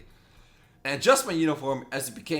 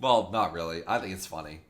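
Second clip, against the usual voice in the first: ordinary talk, nothing marked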